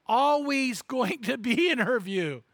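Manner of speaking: normal talking voice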